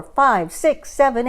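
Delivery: plain reading voice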